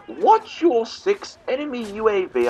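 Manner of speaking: high, slow, hammed-up British voice; mock British accent. Mimicking how the British supposedly talk on games, mock British accent. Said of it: "we don't talk like that".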